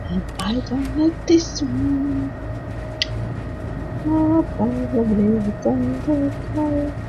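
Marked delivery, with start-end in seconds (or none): none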